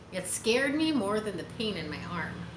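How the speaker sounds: ordinary delivery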